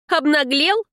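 high-pitched, indignant question; incredulous, angry challenge; outraged. Acted. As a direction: burst out at his cheek. Heroine loses control in anger.

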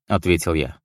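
usual speaking voice

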